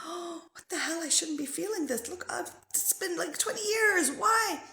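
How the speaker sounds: high, baffled self-questioning; exasperated inner voice. Retold or imitated. Venting to herself about an old hurt, high and baffled. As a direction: ask yourself in disbelief why it still hurts. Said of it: "I'd be like".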